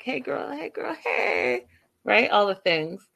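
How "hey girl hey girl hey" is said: catcalling guy. Retold or imitated. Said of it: "He's like".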